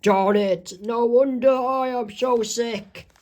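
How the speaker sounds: rising into a high, slow lament; sick patient Horinato. Acted. Mister Horinato laments in his hospital bed, high and drawn-out. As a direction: draw it out high, a put-on complaint. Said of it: "And Mister Horinato says".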